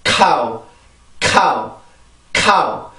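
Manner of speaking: stressed 카우, k released with a strong puff; scraped k demo. Shown as a demonstration. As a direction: burst the k of 카우, then fall away. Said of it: "연구개가 살짝 긁힌다".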